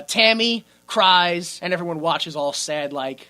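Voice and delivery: usual speaking voice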